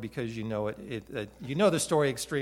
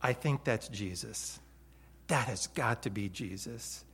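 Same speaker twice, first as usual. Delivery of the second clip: hushed realization; 5 semitones lower, 6 dB quieter than usual. Acted. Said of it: "John boy says to Peter".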